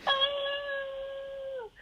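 a very high, crying "Oh!" on reading the letter. Retold or imitated. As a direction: cry out "Oh!" with teary joy. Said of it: "I just started crying".

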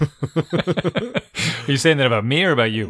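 slow teasing question through a laugh. Real, not acted. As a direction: laugh while asking who is meant.

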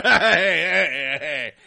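laughing calls, fading from loud; hey-hey through laughter; George laughing. Retold or imitated. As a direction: chuckle out the calls, tailing off. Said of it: "and laughing".

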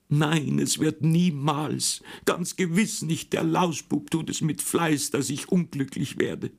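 put-on high, slow whimpering complaint of a crying girl; tearful wail. Sister complains tearfully to her mother while crying. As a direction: sob it out like a spoiled sister. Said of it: "Was hat sie denn schon wieder für eine Heulerei".

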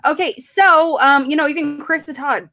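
ordinary talk, nothing marked

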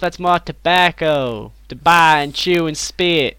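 put-on American accent. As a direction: drawl it like a mock American yokel. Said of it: "talking in a localized American accent".

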